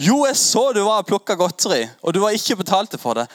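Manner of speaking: angry shopkeeper. Retold or imitated. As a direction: scold the kid you caught.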